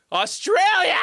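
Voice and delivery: high, loud playful yell; shout. Real, not acted. Streamer shouting at the guest, a playful loud yell. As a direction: blurt it out as a joking protest.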